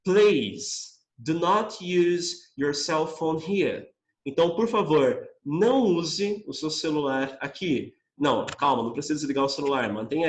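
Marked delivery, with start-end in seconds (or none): 0.0-3.9 slow, deliberate English read; slow model reading. Shown as a demonstration. Teacher reading sign slowly to class. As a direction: spell it out slowly for students. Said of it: "se liga".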